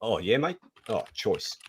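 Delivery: laid-back Kiwi accent, tossed off; New Zealand accent. Shown as a demonstration. Host signs off his demo in a casual New Zealand accent. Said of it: "that's my New Zealand for you".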